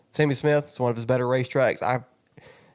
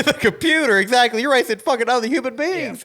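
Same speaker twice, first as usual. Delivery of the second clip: laughing while ranting, voice up high; 9 semitones higher, 3 dB louder than usual. Real, not acted. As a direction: laugh it out, then rant. Laughing, heated agreement.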